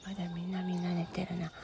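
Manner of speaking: very soft, barely voiced; quiet. Real, not acted. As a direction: hush for the sleepers.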